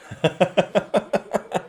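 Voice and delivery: sudden high-pitched laugh; high giddy laugh. Real, not acted. Crowd delights him.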